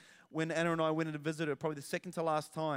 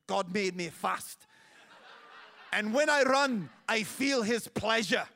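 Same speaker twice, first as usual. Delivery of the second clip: a playful Scottish accent, hammed up a bit; 3 semitones higher, slower than usual. Preacher quoting Eric Liddell in a playful Scottish accent. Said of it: "he probably said it in a Scottish accent".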